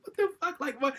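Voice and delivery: shrill angry disbelief while kicking trash on the block; exasperated outburst. Retold or imitated. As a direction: fling it out in disbelief at the universe.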